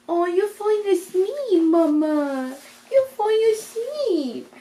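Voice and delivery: high, slow coaxing sing-song; sing-song sweet voice. Retold or imitated. Soothing talk to drowsy mother.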